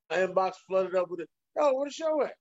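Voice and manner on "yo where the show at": demanding fan. Retold or imitated. Fans' messages voiced.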